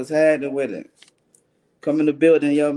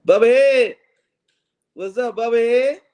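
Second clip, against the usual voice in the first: calling out; 6 semitones higher, 5 dB louder than usual. Real, not acted. Greeting a viewer joining the stream.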